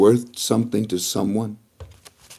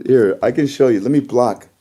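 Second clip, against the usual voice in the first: usual speaking voice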